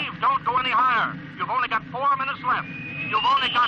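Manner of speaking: high, pressing plea over the radio; urgent pleading. Acted. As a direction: beg him to come back down. Controller pleading pilot to stop.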